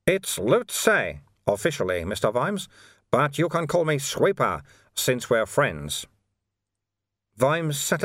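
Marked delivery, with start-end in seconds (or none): 0.1-6.1 lilting, raised character voice; easy-going little sage introducing himself; friendly monk Sweeper. Acted. Sweeper giving Vimes his name, friendly and lilting.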